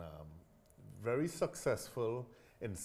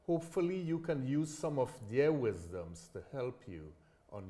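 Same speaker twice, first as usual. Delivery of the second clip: upbeat host announcer read, higher and louder; podcast intro; 5 semitones higher, 9 dB louder than usual. Acted. Host welcoming listeners to his show, bright announcer read. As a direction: sell the show's welcome like a radio host.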